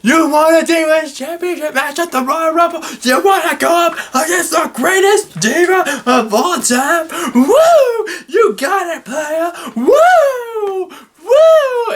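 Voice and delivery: high hammed-up Ric Flair woos. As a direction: ham up a cocky wrestling legend. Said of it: "which pisses off Ric Flair. It's like".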